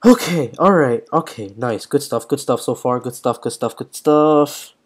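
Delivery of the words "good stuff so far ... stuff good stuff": normal talking voice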